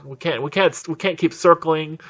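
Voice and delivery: ordinary delivery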